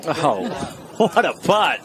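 bursts into a laugh on the putt; delighted laughing exclamation; laughing excitement. Real, not acted. Excited reaction to great putt.

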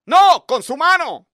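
loud firm command; angry order. Retold or imitated. Teacher ordering a student to kill the mosquito by hand, angry command. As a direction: order it harshly.

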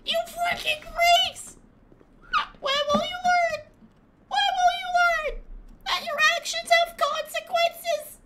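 very high, squeaky imitation of a ranting squeaker. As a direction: squeak out an outraged mock rant, way up high. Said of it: "I can't do his squeaker voice".

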